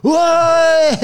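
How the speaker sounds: high, loud shout of amazement; crowd's whoa. Retold or imitated. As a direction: cry out as the whole room gasps. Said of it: "Everyone's like".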